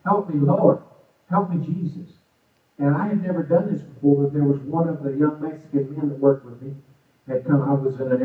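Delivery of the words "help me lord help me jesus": higher, louder pleading prayer; fervent prayer. Retold or imitated. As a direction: cry out to the Lord for help. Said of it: "I'm praying to the Holy Ghost".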